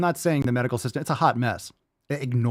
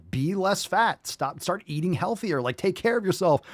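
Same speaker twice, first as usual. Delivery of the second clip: lightly acted doctor, higher and emphatic; doctor impression; 6 semitones higher than usual. Imitated doctor advising a patient, mildly exasperated. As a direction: plead a little, as an imitated doctor. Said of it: "And doctor's like".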